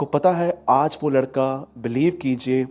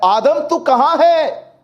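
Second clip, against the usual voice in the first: God calling; 10 semitones higher, 4 dB louder, slower than usual. Acted. God calls out to Adam.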